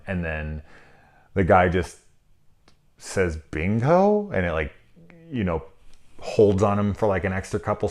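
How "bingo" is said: high, rising, questioning call-out; mimicked bingo call. Reviewer mimics the movie's bingo caller after window crash, tentative call. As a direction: imitate a hesitant bingo caller, pitch climbing. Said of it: "the guy's about to call out bingo".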